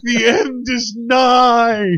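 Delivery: slow high wailing prophecy; doomsday street preacher. Pretend. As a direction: drag out the doom like a prophet. Prophesying doom, slower repeat.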